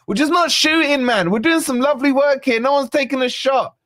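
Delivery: high, fast, exasperated plea to shoot; frustrated excitement. Real, not acted. Frustrated fan, team not shooting.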